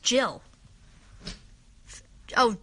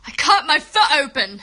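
much louder, insistent outcry; loud exclamation; 9 dB louder than usual. Real, not acted. Girl repeating her injury news to everyone, loud outcry. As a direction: call it out in one loud run.

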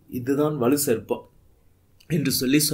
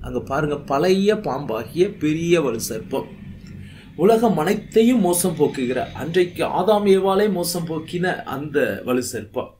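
ordinary talk, nothing marked

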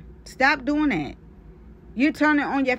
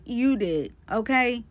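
ordinary talk

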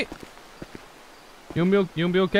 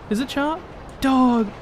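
normal talking voice

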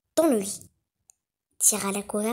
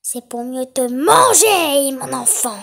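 wolf howls; 10 dB louder than usual. A narrator's reading. The wolf roars at the girl before pouncing, much louder. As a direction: roar it at her. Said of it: "hurla le loup".

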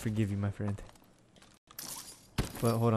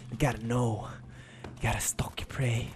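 hushed hunter's whisper; lowered voice while sneaking up; 2 semitones lower, 7 dB quieter than usual. Real, not acted. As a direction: breathe it like you're stalking. Sneaking up on an enemy.

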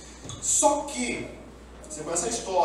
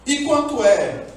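eager question; 7 semitones higher, 8 dB louder, slower than usual. Retold or imitated. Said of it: "Jacó fez assim".

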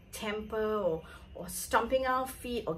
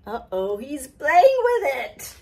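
dismayed; 4 semitones higher, 8 dB louder than usual. Acted. Dismay at the potty.

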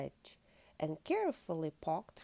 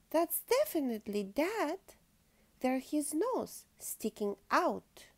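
higher, louder and certain; excited cub; 8 semitones higher, 5 dB louder than usual. A narrator's reading. Patty sure she has spotted Dad, excited and higher. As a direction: point it out eagerly in a raised voice.

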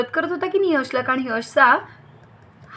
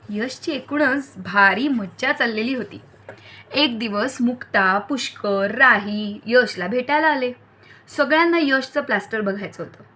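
ordinary delivery

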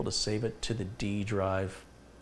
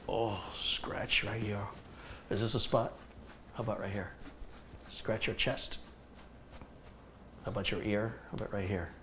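soft pet talk; 9 dB quieter than usual. Real, not acted. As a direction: gently murmur to the dog. Scratching the dog, soft.

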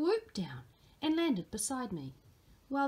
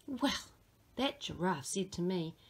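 usual speaking voice

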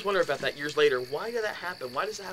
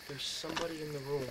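hushed dread; 2 semitones lower, 10 dB quieter than usual. Retold or imitated. Roommate telling him of a presence, hushed and ominous. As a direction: say it softly so it chills. Friend's eerie report retold.

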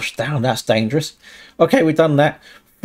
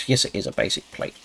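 sarcastic whisper; 5 semitones lower, 8 dB quieter, faster than usual. Pretend. Mocks the game's "basic plate.

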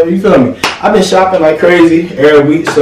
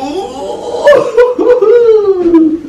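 long high laugh. Real, not acted. Laughing while trying it on.

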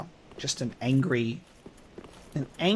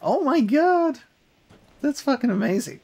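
elated amazement; 9 semitones higher than usual. Real, not acted. Just killed the boss.

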